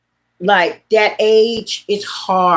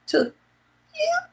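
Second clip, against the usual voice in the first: stronger meow; 7 dB quieter than usual. Shown as a demonstration. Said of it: "Her meows went from".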